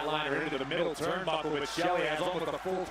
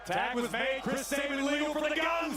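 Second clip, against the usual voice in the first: excited; 9 semitones higher than usual. Real, not acted. Tag called live.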